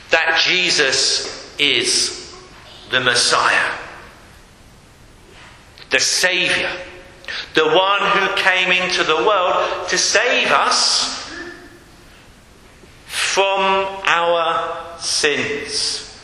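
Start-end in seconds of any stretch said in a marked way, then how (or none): none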